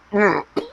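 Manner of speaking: harsh throat-clearing cough; coughing fit. Real, not acted. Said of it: "I just swallowed a gnat".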